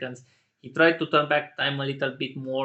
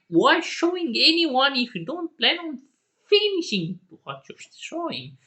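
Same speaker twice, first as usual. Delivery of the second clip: much higher, louder outraged questions; frustrated fan rant; 11 semitones higher, 5 dB louder than usual. Real, not acted. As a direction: vent at the show like a betrayed fan. Upset fan ranting about teasers.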